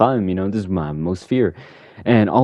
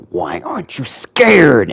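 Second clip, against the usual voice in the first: frustrated Scarecrow; 6 semitones higher, 5 dB louder, slower than usual. Retold or imitated.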